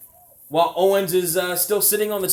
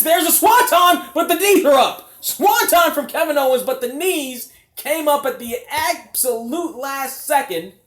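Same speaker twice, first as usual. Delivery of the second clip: much higher and louder call; excited play-by-play; 7 semitones higher, 4 dB louder than usual. Real, not acted. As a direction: shout the big spot. High-flying move called live.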